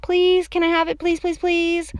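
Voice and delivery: put-on high, drawn-out child's plea; high-pitched pleading; real begging. A narrator's reading. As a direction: beg like a desperate little kid. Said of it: "real begging".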